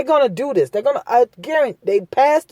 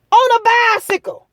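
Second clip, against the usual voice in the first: indignant outcry; 12 semitones higher than usual. Real, not acted. Telling his audience about the bike stop, an outraged high cry. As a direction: shout it high, outraged and disbelieving.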